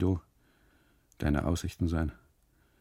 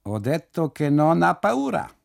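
higher and louder, insistent Italian custodian; custodian speaking Italian; 9 semitones higher, 6 dB louder than usual. Acted. Custodian insists to the trembling boy, loud Italian voice. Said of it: "sagt der Kustode noch einmal".

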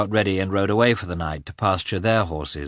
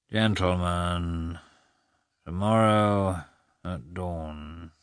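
slow, soft sleepy mumble; drowsy mumble; 4 dB quieter, much slower than usual. A narrator's reading. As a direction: mumble it nearly asleep. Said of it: "Lievin mumbled drowsily".

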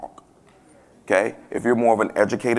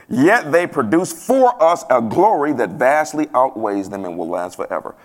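slow, raised proclamation easing down at the end; scripture reading; 3 semitones higher, 3 dB louder than usual. Real, not acted. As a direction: proclaim the verse, then settle. Reads scripture aloud to congregation.

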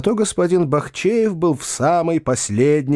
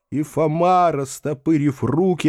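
ordinary delivery, nothing marked

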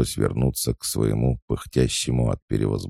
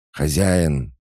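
higher, mildly raised cry; insistent call; 5 semitones higher, 4 dB louder than usual. A narrator's reading. She-wolf interrupts her master's business talk, calling out insistently. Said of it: "игнорируя крики волчицы".

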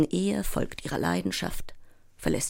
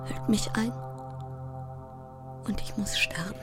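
put-on frightened child; 4 semitones lower, 5 dB quieter than usual. Ten-year-old afraid to sleep after Mama's death, fearful hush. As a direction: whisper into the dark like a scared child.

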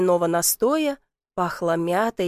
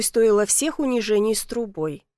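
ordinary delivery

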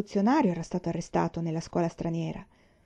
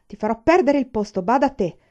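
much louder, higher angry threat; shouting threat; 5 semitones higher, 7 dB louder than usual. A narrator's reading. Aiwan threatening the jailer's job, shouting. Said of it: "gridò".

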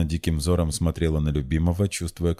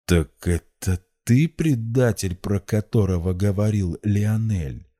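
slow, higher-pitched shocked accusation; stunned; 4 semitones higher, 4 dB louder, slower than usual. A narrator's reading. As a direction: drag the question out in shock. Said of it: "прохрипел поражённо Владимир".

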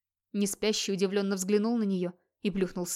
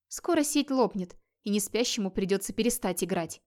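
ordinary delivery

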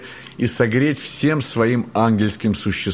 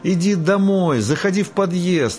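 high, slow call from a window; 8 semitones higher than usual. Acted. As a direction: call the cat home, coaxing. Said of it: "кричала Фаняша". (зовущий крик из окна)

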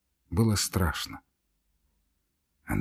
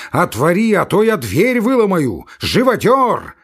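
furious shout; 8 semitones higher, 6 dB louder than usual. Acted. Ivan pounding the door.

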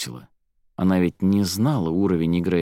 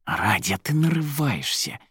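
tense, higher-pitched reproach; angry hiss; 6 semitones higher than usual. A narrator's reading. Volya reproaches his pretend girlfriend, hissing at her. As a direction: hiss a warning at your accomplice. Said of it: "прошипел тот".

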